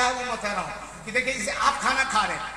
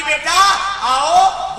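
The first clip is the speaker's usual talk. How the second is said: put-on a mother's raised call to her child; mother calls her little son to eat together, raised; 6 semitones higher, 9 dB louder, much slower than usual. A narrator's reading. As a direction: call out to the boy, louder. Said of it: "माँ कहती".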